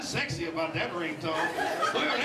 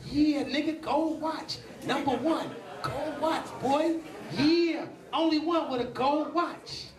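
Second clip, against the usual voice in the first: put-on bragging kid; 6 semitones higher, much slower than usual. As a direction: kid crowing over a gold watch.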